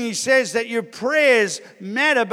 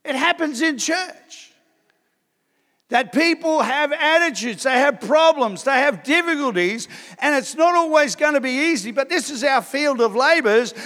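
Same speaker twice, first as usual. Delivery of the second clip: higher, louder pulpit voice; raised sermon delivery; preaching lift; 5 semitones higher, 3 dB louder than usual. Real, not acted. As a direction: preach it with force.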